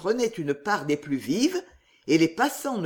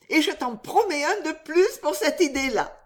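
high, playful promise; delighted Riquette; 8 semitones higher than usual. Acted. Riquette promising Riquet an extra kiss, high and delighted. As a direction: sing out the reward.

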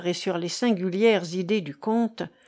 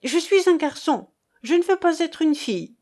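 lively, higher-pitched protest; animated, high-pitched insistence; great vivacity; 6 semitones higher than usual. A narrator's reading. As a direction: protest with quick spirit, pitch lifted. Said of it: "en disant avec une grande vivacité".

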